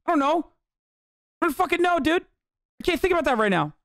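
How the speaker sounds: high, agitated protest; acted distress. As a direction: act out being too overwhelmed to think. Acting out a distraught husband's answer.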